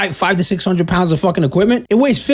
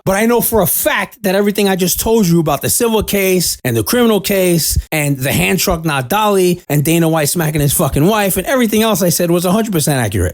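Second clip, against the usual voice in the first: normal talking voice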